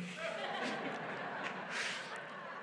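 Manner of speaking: high airy giggle, barely voiced; helpless silent laugh; quiet wheezing giggle; breathless giggle. Real, not acted. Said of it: "Sorry, sorry about that".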